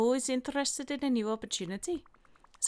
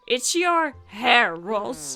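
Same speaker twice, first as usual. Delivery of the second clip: louder, slowed-down repeat; louder slow mock outburst; 9 dB louder, much slower than usual. [name] hammily accusing Roz about her hair. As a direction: stretch it out for emphasis.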